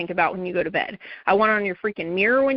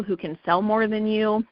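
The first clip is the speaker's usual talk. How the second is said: normal talking voice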